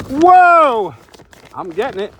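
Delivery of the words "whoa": loud startled whoa; high, loud burst of surprise; surprised whoa. Real, not acted. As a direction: gasp out in surprise at the find. Surprised by a giant potato.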